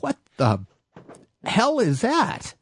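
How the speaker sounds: slow, drawn-out high "hell"; stunned disbelief at the music; mock astonished double take; amazed. Stops on sidewalk, amazed.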